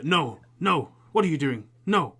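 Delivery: loud, high cries of alarm; acted panic, pitch jumping on each protest; Samuel shouting. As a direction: cry out as if being attacked. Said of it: "the sound of Samuel shouting".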